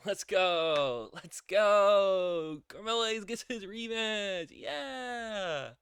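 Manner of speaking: hyped fan cheering; excited cheer. Real, not acted. Cheering the rematch.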